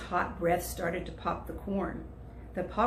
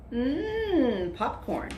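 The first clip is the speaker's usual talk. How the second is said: hungry mm; 7 semitones higher, 3 dB louder, much slower than usual. Acted. Animals smelling popcorn.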